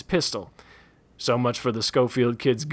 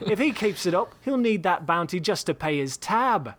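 put-on higher British-accented voice; a hint of an English gent; English Bob ribbing Daggett at cards, British accent; 6 semitones higher than usual. A narrator's reading.

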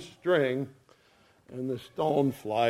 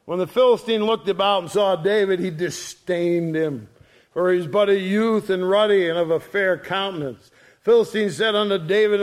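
fast, raised reading voice; scripture reading; 4 semitones higher, 5 dB louder, faster than usual. Acted. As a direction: read it out like a preacher.

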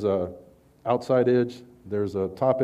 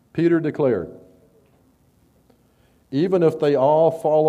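raised, measured Bible reading voice; scripture reading; 5 semitones higher, 5 dB louder than usual. Acted. Preacher reading Mark fourteen to the congregation, raised solemn reading. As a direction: read the passage out to the congregation with weight.

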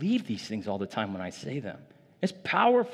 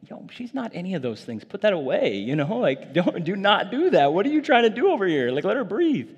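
higher and louder, hammed-up indignant uncle; mock protest; 8 semitones higher, 6 dB louder than usual. Uncle objecting to the truths posted for niece, mock-indignant. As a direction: overprotect her with comic outrage. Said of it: "And I'm like".

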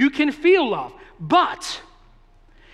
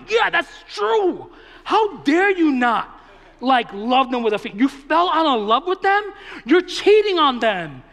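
mock outrage; 5 semitones higher, 4 dB louder than usual. Pastor egging on the students, hammed-up outrage. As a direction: go off in playful fake outrage. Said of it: "I was like".

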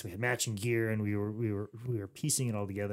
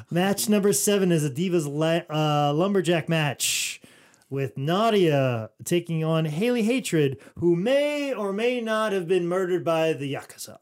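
higher, loud, drawn-out announcer delivery; ring announcer; 7 semitones higher, 10 dB louder, slower than usual. Acted. Reads out the wrestling match card like a ring announcer. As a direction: announce the match with big, stretched-out words.

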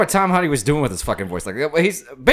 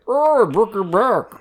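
Bane voice; 7 semitones higher, much slower than usual. Shown as a demonstration. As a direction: growl it like Bane through a mask. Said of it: "Give me a Bane quote".

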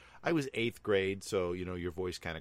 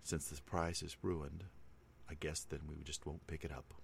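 deadpan parent; 3 semitones lower, 8 dB quieter than usual. Retold or imitated. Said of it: "completely straight-faced".

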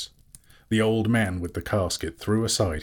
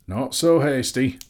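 firm bowman Bard; 7 semitones higher, 5 dB louder than usual. A narrator's reading. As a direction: call him back, unhurried and firm. Bard interrupts Thorin.

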